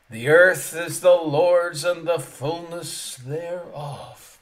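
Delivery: loud, slow, weighty reading; slow proclamation. Acted. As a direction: give each word its weight. Proclaims scripture slowly.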